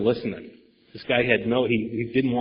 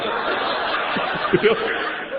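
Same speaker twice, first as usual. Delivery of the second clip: real laugh; 7 semitones higher than usual. Real, not acted. Laugh after the joke.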